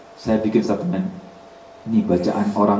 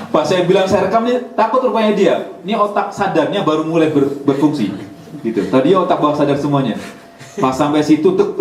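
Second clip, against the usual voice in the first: ordinary talk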